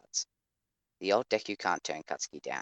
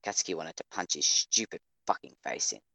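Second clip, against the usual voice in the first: ordinary talk, nothing marked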